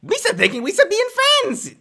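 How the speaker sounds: loud, very high cartoon squeal; high-pitched excitable alien voice; goofy alien. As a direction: squawk it like a silly creature. Performs Jar Jar line.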